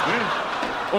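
ordinary talk

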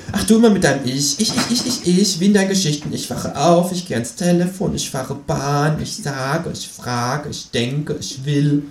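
mocking kangaroo.

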